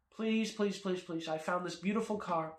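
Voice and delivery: soft, repeated begging; mock pleading. Kid begging father for a car, mock pleading. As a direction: plead softly like a kid working on a parent.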